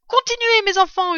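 appel lancé très haut et fort; hurlement. A narrator's reading. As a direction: encourage la troupe en criant. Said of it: "hurlait maître Renard". (renard qui hurle à ses enfants)

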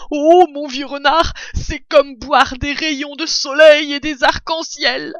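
high, loud, swooning delight; enraptured badger. A narrator's reading. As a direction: gush as if tasting heaven. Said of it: "soufflait-il". (ravissement, voix haute et forte)

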